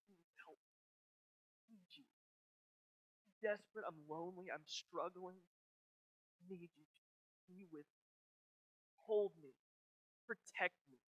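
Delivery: soft, broken prayer of need; quiet desperate prayer. Acted. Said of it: "I just need to sit and just say".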